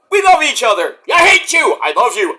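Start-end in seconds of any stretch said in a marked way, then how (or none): 0.1-1.0 feuding partner. Retold or imitated. Voicing the feuding tag team. 1.1-1.8 high, loud mock snarl; mock angry. Tag partner yelling at the other, high and loud. Alternating hate and love.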